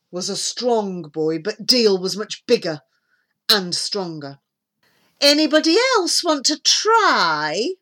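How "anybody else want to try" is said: high, loud taunt; mildly mocking villain's challenge; grinning challenge. A narrator's reading. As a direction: dare the others with a grin.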